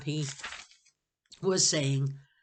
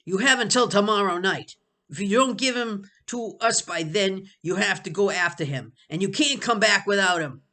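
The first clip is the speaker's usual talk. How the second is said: higher, louder threat; loud ultimatum; 6 semitones higher, 9 dB louder than usual. Acted. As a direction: deliver the ultimatum hard. Sebastian's ultimatum.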